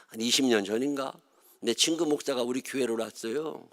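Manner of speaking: ordinary delivery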